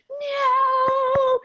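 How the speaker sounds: loud, high, drawn-out mock cry; mock protest. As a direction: imitate an exaggerated, horrified no. Retold husband's protest about accent.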